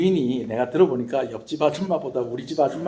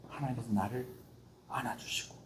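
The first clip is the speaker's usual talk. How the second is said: whisper; 3 semitones lower, 16 dB quieter than usual. Real, not acted. Whispered in sermon.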